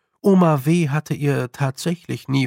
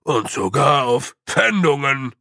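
louder character voice, dread; name the worst horror of all; mild panic at the dreaded word; panicky little man; 4 dB louder than usual. A narrator's reading. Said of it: "sahen sich voller Panik um".

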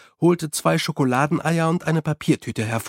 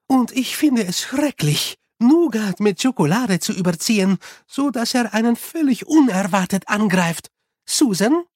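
higher, lively character voice; animated Unity; 7 semitones higher, 4 dB louder than usual. A narrator's reading. Unity reports on the chocolates left, animated. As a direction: voice a keen, animated companion.

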